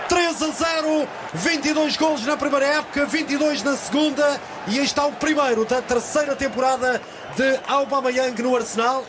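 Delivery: soaring, shouted goal call; explosive goal commentary. Real, not acted. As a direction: erupt as the ball hits the net.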